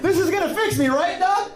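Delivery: a hammed-up trusting patient asking the doctor; mock gullible patient. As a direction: play the naive patient through your own laughter.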